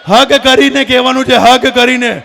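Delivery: loud, emphatic order over a crowd; calling out instructions. Real, not acted. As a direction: make the whole hall hear it.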